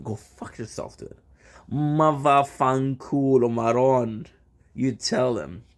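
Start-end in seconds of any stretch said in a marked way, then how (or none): 0.0-1.2 whispered insult. Shown as a demonstration. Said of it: "So you tell them".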